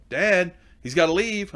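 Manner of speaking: high, slow, drawn-out call as the daughter; daughter calling dad. Retold or imitated. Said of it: "I heard Chase go".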